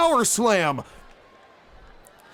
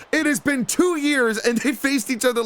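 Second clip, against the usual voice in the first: ordinary delivery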